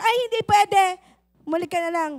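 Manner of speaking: high, loud brush-off; rude secretary. Acted. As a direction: shoo the visitor away. Secretary rudely refusing visitor. (mataray na sekretarya, mataas at malakas)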